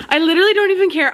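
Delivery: high, loud, quick burst with a laugh; giddy insistence. Real, not acted. Reader on scaring the neighbors, giddy. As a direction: blurt it out fast through laughter. Excited, dismisses having scared neighbors.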